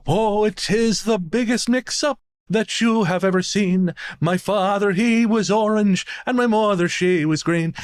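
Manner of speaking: high, slow put-on old Irish lady; slow, high elderly lady voice leaning in. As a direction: play the sweet old lady with a dramatic reveal. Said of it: "looks me square in the eye and goes".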